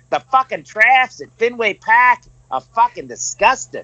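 doing the Boston guy and cracking up mid-line; Boston accent. Retold or imitated. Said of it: "hardcore Boston accent".

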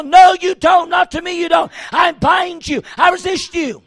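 fierce shouted rebuke; yell at the devil. Shown as a demonstration. Preacher demonstrating to the woman how to yell at the devil. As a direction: snap it at the devil like a rattlesnake. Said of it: "yell at the devil like a rattlesnake".

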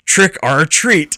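Trick or treat said slowly, each word separated. Retold or imitated. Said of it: "Anderson writes back".

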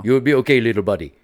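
lightly imitated Senegalese accent. African friend reassures the injured singer, in a Senegalese accent. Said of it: "He's, like, from Senegal".

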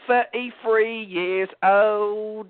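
slow Cockney, th as f; Cockney accent. Retold or imitated. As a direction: drag out the Cockney number. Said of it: "the key for the Cockney thing".

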